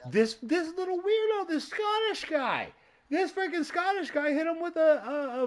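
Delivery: high incredulous voice about the little Scottish weirdo; squeaky mock voice. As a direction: squeak it out in disbelief. Mocking the Scottish wrestler.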